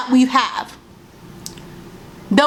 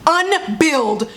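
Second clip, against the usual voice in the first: mocking retort; 5 semitones higher, much slower than usual. Real, not acted. Mocking retort to predator men.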